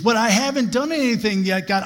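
a pleading protest to God; protesting listener. Pretend.

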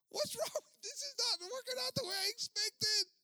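very high, loud squealing panic; panicked disbelief. Retold or imitated. Pastor panicking that his enemy is on the line. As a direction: squeal in horror.

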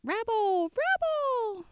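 very high, hammed-up evil voice; mock Satan voice. Host testing the caller's story, in a hammed-up evil voice. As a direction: ham up a spooky devil voice. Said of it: "Was it like".

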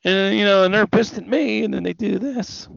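mocking the guy.

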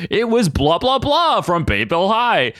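high, drawn-out cartoon unmasking announcement; Scooby-Doo style reveal. Kurt unmasking an intruder, hammy cartoon reveal. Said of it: "he unmasks one Scooby-Doo style, and he was like".

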